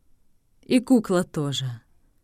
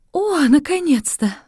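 exclamation; 9 semitones higher, 4 dB louder than usual. Acted. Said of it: "воскликнула сеньора Лиза".

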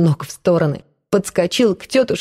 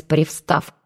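usual speaking voice

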